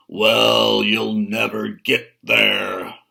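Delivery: loud, slow troll boom; thundering troll. Acted. Troll thunders at the second billy goat. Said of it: "thundered the troll".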